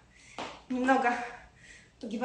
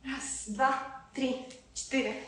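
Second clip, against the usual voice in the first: counting workout reps; 8 dB quieter, much slower than usual. Real, not acted. Counting reps during plank exercise.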